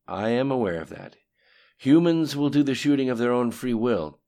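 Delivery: brisk, projected briefing to a superior; reporting underling. A narrator's reading. Lim Shun reports on resources to the voice, lightly acted briefing.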